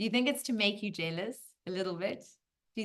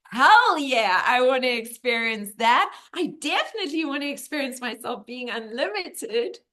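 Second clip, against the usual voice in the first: loud, fired-up acceptance; acted burst of eager enthusiasm; excited little soul; 4 semitones higher, 9 dB louder than usual. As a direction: cheer it out, high and emphatic.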